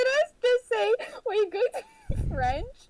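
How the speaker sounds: high, slow, laughing question; laughing disbelief. Real, not acted. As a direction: laugh at your own slip as you ask. Laughing at her own slip.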